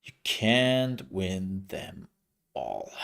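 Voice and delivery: very slow, dropping low on all; slow and dragged out. Pretend. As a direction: drag it out wearily, sinking to the end. Repeats the phrase, then drawn out.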